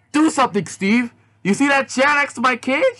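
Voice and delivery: high, urgent pleading; lightly voiced panicked girl. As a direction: push him to act fast. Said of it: "Yazzie asked".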